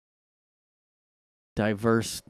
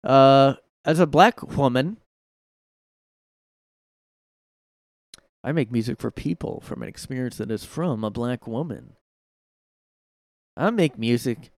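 imitating [name]; 3 dB louder than usual. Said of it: "she talks".

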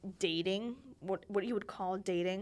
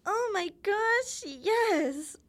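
high, slow, swooning yes; thrilled yes; 10 semitones higher, 5 dB louder, much slower than usual. Retold or imitated. Girl accepting a proposal at recess, swooning with joy. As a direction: gush it like a dream come true.

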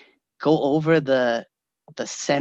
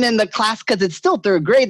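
usual speaking voice

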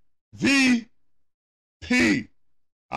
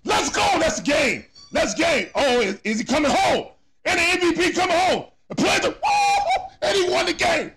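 excited shouting; 6 semitones higher than usual. Real, not acted. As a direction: yell it out at the walk-off win. Walk-off win celebration.

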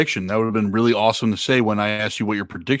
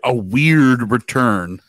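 slow clear repeat; much slower than usual. Real, not acted. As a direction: spell out weird return slowly. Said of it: "A what return?".

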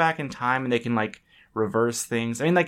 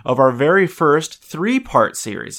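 slow, raised announcer welcome; show intro; 3 semitones higher, 5 dB louder than usual. Acted. Host opening the episode in a slow announcer voice.

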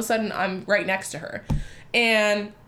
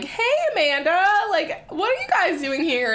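coworkers' excited greeting; 9 semitones higher than usual. Retold or imitated. Coworkers spotting her at Chili's, high excited greeting. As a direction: greet a friend in a raised, surprised voice.

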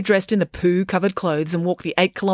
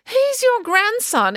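high, slow, astonished question; exclaiming; slower than usual. A narrator's reading. Finn learns Neil is the cat lady's grandson, high astonished exclaim. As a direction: let the shock burst out of Finn. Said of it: "exclaimed Finn".

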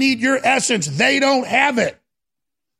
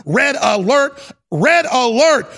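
slow, louder red alert, each word hit; emphatic alarm; 2 semitones higher, 4 dB louder, slower than usual. Real, not acted. Host chanting red alert to viewers. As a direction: sound the alarm.

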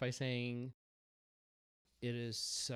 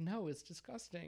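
mock protest; 6 semitones higher, 4 dB quieter than usual. Own protest acted in retold exchange.

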